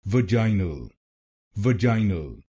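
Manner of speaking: "vaginal" said British way, stress on the long "jai"; British pronunciation. Shown as a demonstration. Teacher demonstrating British "vaginal" to Hindi learners. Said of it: "British English में इसको बोलेंगे".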